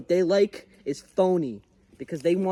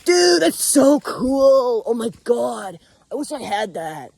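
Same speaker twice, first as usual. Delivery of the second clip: imitating amazed viewers; 6 semitones higher, 5 dB louder, slower than usual. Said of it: "you guys will be like".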